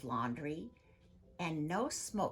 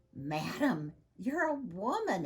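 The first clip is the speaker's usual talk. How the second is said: a touch of shocked exclamation, louder; exclaiming; 5 semitones higher, 6 dB louder than usual. Acted. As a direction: protest in disbelief. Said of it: "exclaimed the man".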